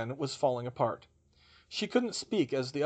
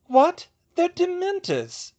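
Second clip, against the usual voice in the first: very high, loud outburst; incredulous protest; 9 dB louder, slower than usual. A narrator's reading. Professor accused of personal bias, incredulous protest. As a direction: protest as if it were obvious. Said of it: "she protested".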